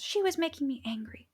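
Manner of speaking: a hint of a child's complaint; lightly voiced child. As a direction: protest like an upset kid, lightly acted. Retold upset child protesting to adult.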